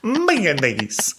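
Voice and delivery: put-on MAYONNAISE drawn out high in a silly accent; goofy made-up foreign accent; unplaceable accent. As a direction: say mayonnaise in an accent nobody can place. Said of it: "I don't even know what that accent is".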